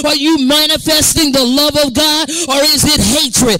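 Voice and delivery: loud, driving, relentless sermon delivery; full-force preaching. Real, not acted. Preacher challenging the church on what they manifest, full-force preaching. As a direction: preach it hard without letting up.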